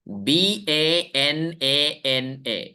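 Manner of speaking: बी ए एन ए एन ए letter by letter, slowly; slow, evenly spaced letters; spelled out. Shown as a demonstration. Teacher spells the word for students, slow letter by letter.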